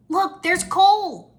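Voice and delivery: high, drawn-out excited stage call; excited hushed call. A narrator's reading. Jay spots Cole among the prisoners, excited stage whisper. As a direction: point him out with a loud, eager stage whisper. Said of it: "Jay whispered".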